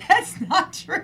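high, breathless laughing words. Real, not acted. Laughing hard at joke.

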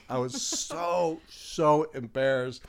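drawn-out "so", laughing at oneself; laughing embarrassment. Retold or imitated. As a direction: stretch it out, embarrassed and amused.

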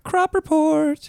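high, slow hyped announcer. Pretend. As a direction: call out the show name. Hyping up the show name.

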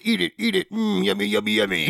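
high, singsong mock bully; schoolyard bully taunt. Voices the bully rubbing a face in slime, taunting high. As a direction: tease like a playground bully. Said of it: "You like".

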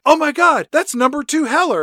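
high, loud mock gasp of alarm; mock shocked Pam. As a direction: ham up a startled cry. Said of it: "Pam's like".

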